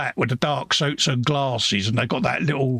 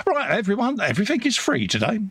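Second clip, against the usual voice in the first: high, fast mock boss announcement; quick, raised voice declaring it all free; excited announcement; 9 semitones higher, faster than usual. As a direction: play the boss throwing open the doors. Pete retold giving free rein.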